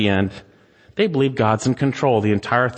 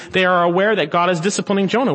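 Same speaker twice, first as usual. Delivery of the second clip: normal talking voice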